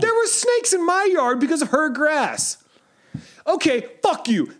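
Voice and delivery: high, slow ranting complaint; mock rant at neighbor.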